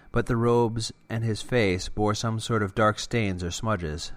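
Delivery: plain reading voice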